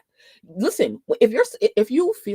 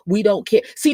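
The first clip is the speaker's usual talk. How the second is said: ordinary talk, nothing marked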